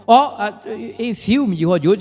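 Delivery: ordinary talk, nothing marked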